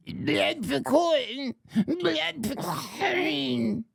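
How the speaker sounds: high, very slow chanting kobold creature; a little kobold chanting its slogan, drawn out high. Acted. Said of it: "I'll do it in kobold voice".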